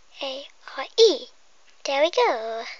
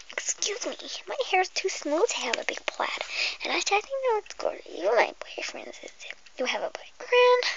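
whisper; 5 semitones higher than usual. Acted. Whispering to the viewer before the pretend hairdresser game. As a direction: breathe every word like an ASMR intro.

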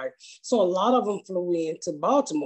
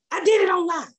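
higher, louder retold yell, irritated; mock yell; 8 semitones higher, 3 dB louder than usual. Retelling a rude man yelling at the clerk, mock yell. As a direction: snap it like a rude customer. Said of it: "he was just yelling at her".

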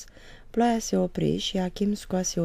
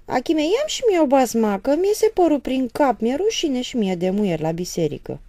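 pleading; 9 semitones higher, 7 dB louder than usual. Acted. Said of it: "se rugă apoi mama".

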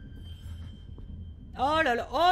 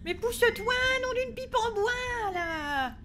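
high, drawn-out wail; stretched-out cry of exasperated panic; panicked exasperation; 8 semitones higher, slower than usual. Real, not acted. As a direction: wail at it to move. Panicking at obstacle in horror game.

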